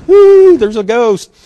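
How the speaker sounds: high, loud, hammed-up scared squeal; mock little-girl fright. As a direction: shriek in pretend terror like a little girl. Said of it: "they're being little girls".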